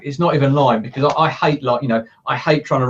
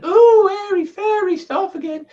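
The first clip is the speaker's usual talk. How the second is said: much higher, slow, scoffing sceptic voice; mocking sceptic; slower than usual. Coach mimicking doubters who scoff at affirmations, high and mocking. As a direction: sneer at it like a cynic who thinks it's nonsense. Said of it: "some guys going".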